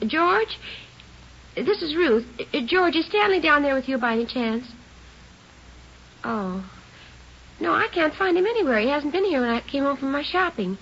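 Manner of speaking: a mother's rising worry over the phone line; strained, high-pitched plea to her husband; worried phone call. Acted. Mother phones husband about missing son.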